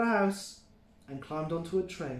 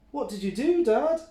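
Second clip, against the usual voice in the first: put-on high, louder child voice; eager little one wanting more; kid goat asking; 8 semitones higher, 6 dB louder than usual. A narrator's reading. Mike presses dad for the story, high and keen. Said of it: "asked Middle-sized Mike".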